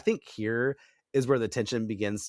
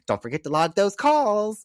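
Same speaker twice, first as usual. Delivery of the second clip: high, loud prissy roommate voice; squeaky mock of a nagging roommate; mocking Kathy; 10 semitones higher, 6 dB louder than usual. Friend mocks the bossy roommate, high and loud.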